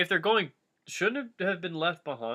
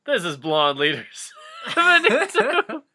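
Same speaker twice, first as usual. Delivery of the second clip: laughing; 8 semitones higher, 7 dB louder than usual. Acted. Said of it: "Legolas picked up the radio".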